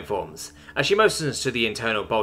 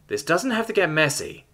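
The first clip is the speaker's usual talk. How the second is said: higher-pitched, controlled warning; 7 semitones higher than usual. A narrator's reading. Terran warning an armed creature, controlled. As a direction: warn them off without shouting. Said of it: "Simone warns".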